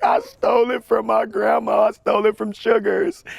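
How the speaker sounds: put-on high, tearful child's voice; crying confession. Guilty boy confesses theft to his mom, tearful and high. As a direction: blurt it out like a guilty crying kid.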